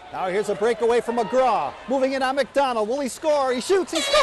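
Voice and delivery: loud, high, rising goal call; excited goal call. Real, not acted. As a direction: build up and explode on the goal.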